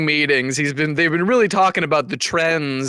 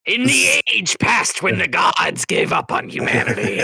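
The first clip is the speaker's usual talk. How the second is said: epic storyteller; 4 semitones higher than usual. Acted. Epic tale of the Cum Goblin.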